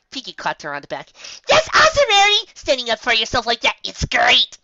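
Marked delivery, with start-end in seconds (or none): 1.5-4.6 very high, loud delight; excited cheer. A narrator's reading. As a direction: cheer her on. Pinkie cheering Rarity on.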